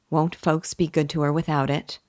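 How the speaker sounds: ordinary delivery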